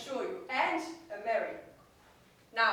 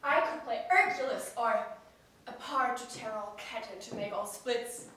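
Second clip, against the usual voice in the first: normal talking voice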